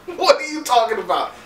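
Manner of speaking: ordinary talk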